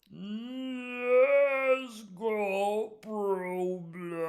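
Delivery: very slow, high, drawn-out movie slow-mo voice; hammed-up slow-mo movie voice, long drawn vowels; dramatic slow motion voice. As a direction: stretch every sound like a film in slow motion. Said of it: "in his best dramatic slow motion voice".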